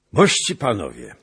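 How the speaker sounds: loud, high toast to his knights; prince hailing guests. A narrator's reading. Prince addressing his feasting knights, loud and merry. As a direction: raise the cup and call out to the hall. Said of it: "rzekł książę".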